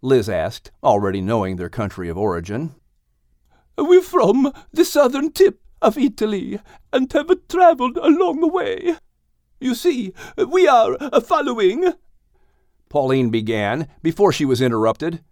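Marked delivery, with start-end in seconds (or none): 3.8-11.9 put-on high Italian-accented mare; Pauline's Italian accent. A narrator's reading. Pauline explains their journey in high Italian-accented voice. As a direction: lift the voice high and speak with an Italian lilt.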